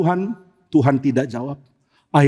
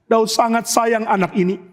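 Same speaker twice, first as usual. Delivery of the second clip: ordinary talk, nothing marked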